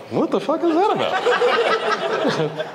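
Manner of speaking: baffled, half laughing; incredulous. Retold or imitated. Incredulous reaction to pigtails rule.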